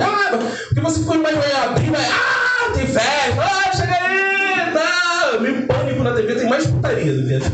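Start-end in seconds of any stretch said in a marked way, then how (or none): 0.0-5.4 mock party hype. Mocking what people imagine there.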